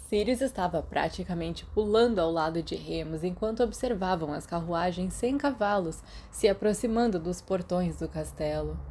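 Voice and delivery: usual speaking voice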